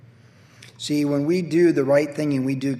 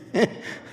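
soft breathy laugh; 6 semitones higher, 4 dB quieter than usual. Real, not acted. Laughs at his own mistake.

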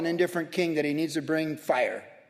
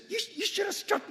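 mock angry rebuke; 13 semitones higher, 6 dB quieter than usual. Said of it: "He was mad".

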